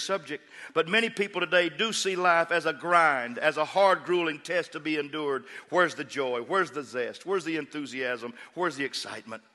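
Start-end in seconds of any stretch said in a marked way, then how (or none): none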